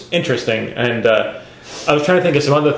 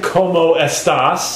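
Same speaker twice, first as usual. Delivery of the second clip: slow, showy Spanish accent; 4 semitones higher, slower than usual. Shown as a demonstration. Host proving she has more accents, hammed-up Spanish. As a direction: show off a Spanish accent, slightly higher. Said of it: "Listen to Spanish accent".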